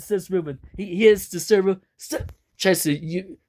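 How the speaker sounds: plain reading voice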